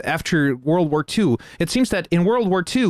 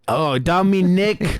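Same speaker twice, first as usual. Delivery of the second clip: slow attempted Cockney, copying me neck; 4 semitones higher, slower than usual. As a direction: try the Cockney phrase slowly. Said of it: "I really gotta practice this, this Cockney".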